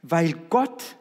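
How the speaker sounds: preacher driving home the point; preaching at full force. Acted. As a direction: proclaim it to the whole church. Preaching climax.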